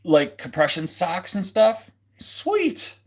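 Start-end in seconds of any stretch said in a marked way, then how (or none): 2.2-3.0 squeaky very high delight; excited. Real, not acted. Reading a fan's idea, excited high squeak. Reacting to chat suggestion.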